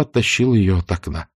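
usual speaking voice

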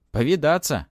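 high, loud impulsive answer; blurted out. A narrator's reading. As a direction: burst out without thinking. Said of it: "выпалил".

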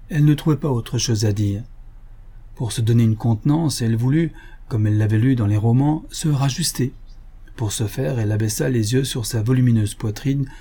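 plain reading voice

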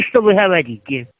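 ordinary talk

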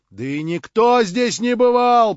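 loud, high, drawn-out protest; voices calling out. A narrator's reading. Others at the meeting back him up, calling out loudly. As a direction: protest for the whole room. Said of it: "послышались опять голоса".

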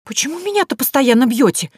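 high-pitched indignant protest. A narrator's reading. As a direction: complain loudly at unfair treatment. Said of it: "возмутилась Василиса". (возмущённо, на высоких нотах)

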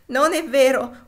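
loud, high, distressed yell; anguished shout, pitched high; yelled denial. A narrator's reading. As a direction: yell the denial as if close to sobbing. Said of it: "urlò Topsy singhiozzando fortemente".

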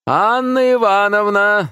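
high, drawn-out holler; calling out loudly. A narrator's reading. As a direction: holler through the flat for her. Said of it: "закричал Лео". (громко, протяжно звать)

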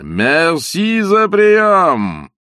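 loud, very slow grand call; drawn-out shout. A narrator's reading. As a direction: stretch it out like a departing dignitary. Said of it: "закричал он на прощание".

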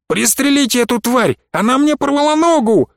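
высокий надрывный крик наёмника; требуй помощи, крича на высокой ноте; крик раненого. A narrator's reading. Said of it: "заорал ещё один наёмник". (высоким паническим криком)

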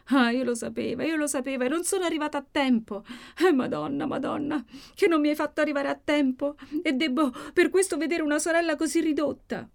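high-pitched, wailing self-reproach; anguished lament. A narrator's reading. Maddalena arrived too late for her sister, anguished lament. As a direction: lament it high, close to tears.

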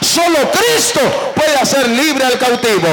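loud fervent preaching shout; preaching at full force. Acted. Preacher rousing the congregation, loud and fervent. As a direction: build to a roaring call for applause.

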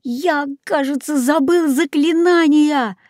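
high-pitched, plaintive parrot voice; plaintive cry. A narrator's reading. Jaco admits he forgot the spell, plaintive cry. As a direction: wail it high and helpless. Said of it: "жалобно закричал".

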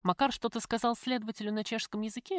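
usual speaking voice

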